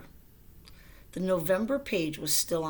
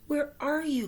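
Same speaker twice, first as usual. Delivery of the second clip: high, louder call into the dark; 9 semitones higher, 3 dB louder than usual. Acted. Frog calls Toad in the dark house, high call. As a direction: call out high across the room. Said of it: "called Frog".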